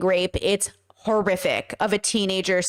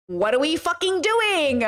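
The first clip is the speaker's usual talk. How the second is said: high, slow exasperated whine; raised, drawn-out complaint; 6 semitones higher than usual. Real, not acted. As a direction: drag out the last word in despair. Exasperated rant over age gap.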